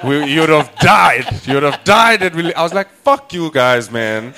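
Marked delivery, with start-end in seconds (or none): 0.1-2.2 high, loud insistence on "died"; hammed-up retold alarm; mock dire warning. Quotes doctors' dire warning. 3.0-4.3 loud, high retort; comic outburst of annoyance; mock indignation. Retorts to the doctors.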